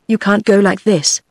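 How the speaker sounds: ordinary delivery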